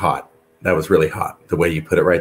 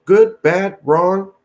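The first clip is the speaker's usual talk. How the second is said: excited host; 7 semitones higher than usual. Real, not acted. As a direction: pump up the big question for the guest. Host excitedly pitches big question.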